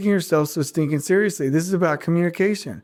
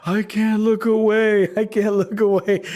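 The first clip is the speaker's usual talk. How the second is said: higher, slow, dazed voice of a screen-glued manager; mock zombie stare; 6 semitones higher than usual. Imitating a coworker hooked on email, dazed and slow. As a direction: drone it like someone hypnotized by email.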